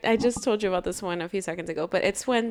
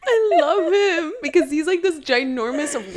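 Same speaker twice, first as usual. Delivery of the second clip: high, loud, laughing adoration; laughing gush; 9 semitones higher, 5 dB louder than usual. Real, not acted. Gushing while laughing.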